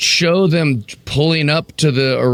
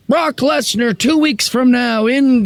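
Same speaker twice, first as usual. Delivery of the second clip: mock hype announcer; 10 semitones higher, slower than usual. Mocking an over-hyped announcement.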